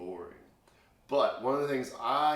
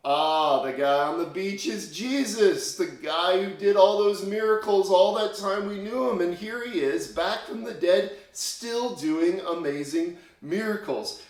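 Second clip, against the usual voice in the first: much higher and louder, bursting with wonder; excited disciples; 8 semitones higher, 8 dB louder than usual. Retold or imitated. Disciples realizing the man on the beach is Jesus, thrilled and loud. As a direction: gush it out like a sudden discovery. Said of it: "they're so excited".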